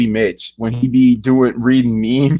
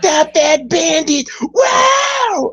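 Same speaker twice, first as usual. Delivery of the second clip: high, hammed-up yelp; imitating Spenguli; much slower than usual. Retelling the song's background voice, mock yelp. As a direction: squeal it like the background voice. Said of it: "Spenguli in the background saying".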